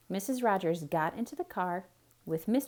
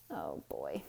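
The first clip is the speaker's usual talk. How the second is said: mock dismay; 10 dB quieter than usual. Real, not acted. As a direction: sink low in mock despair. Teacher groans at mistake.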